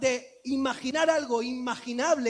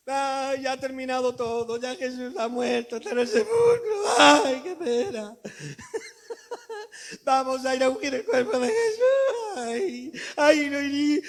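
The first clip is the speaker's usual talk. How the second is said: mock weeping; 2 semitones higher than usual. Preacher playing the weeping women, mock crying. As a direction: wail over the tomb, comic. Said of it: "y iban llorando".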